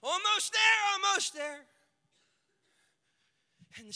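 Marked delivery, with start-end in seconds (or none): 0.0-1.7 shouted encouragement. Retold or imitated. Servant urging Naaman on.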